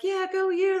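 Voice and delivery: high, loud cheer of a proud family. Pretend. Family seeing the child off, cheering loudly and high. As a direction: send them off with a bright shout. Family sending soul off to school.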